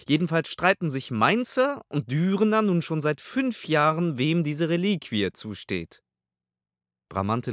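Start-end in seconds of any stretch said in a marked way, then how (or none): 0.1-6.0 a haughty pontiff asking his servant; pope's voice. A narrator's reading. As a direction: pope addressing his servant Lorenzo, haughty and higher. Pope voiced in audiobook.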